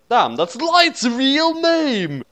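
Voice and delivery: high, slow shocked cry; shocked exclaim. A narrator's reading. L finds Light's name in the book, high slow shock. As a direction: gasp it out stunned.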